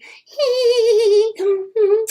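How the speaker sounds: high, loud taunting cackle; mocking laugh. Melanie laughing at her cousin, high mock laugh. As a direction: laugh at him to his face. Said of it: "she kept staring at me like".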